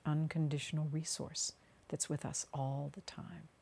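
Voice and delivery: unhurried meditation voice with long pauses; guided meditation. Acted. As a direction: guide them gently down into the body, phrase by phrase.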